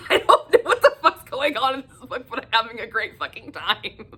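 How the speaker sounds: amused, baffled laughing quote; laughing disbelief. Retold or imitated. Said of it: "I was like".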